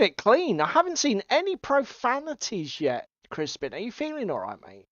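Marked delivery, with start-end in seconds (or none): none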